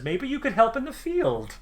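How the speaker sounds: high, scolding little farmer voice; fussy halfling scolding his guest; high-pitched halfling farmer telling off. Acted.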